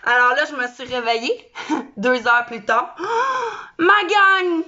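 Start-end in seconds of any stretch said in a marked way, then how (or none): 3.0-3.7 gasp. Acted. Sudden dramatic gasp. 3.8-4.6 loud, excited hello to the crew; excited shout. Real, not acted. Excited greeting to her viewers.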